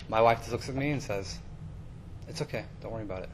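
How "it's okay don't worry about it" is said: soft, low, quick comforting words; gentle reassurance. Retold or imitated. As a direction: imitate a forgiving wife, hushed and low. Forgiving wife comforts him, retold.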